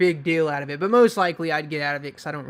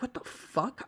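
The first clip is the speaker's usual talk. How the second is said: confused; 7 semitones higher, 8 dB quieter, slower than usual. Retold or imitated. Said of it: "I was super confused".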